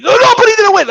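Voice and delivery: very high protesting outburst; hammed-up fan shouting in denial; mock yelling. Vlogger imitates fans yelling at him, mock yelling. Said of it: "yelling at me".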